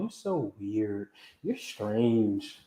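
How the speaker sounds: a hint of a teenage daughter teasing her dad; mock daughter. Dad lightly mimicking his daughter calling him weird. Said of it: "that's what she be calling".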